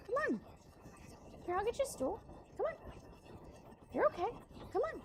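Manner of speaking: high coaxing pet voice; talking to a dog. Real, not acted. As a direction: coax the puppy up gently. Coaxing dog onto stool.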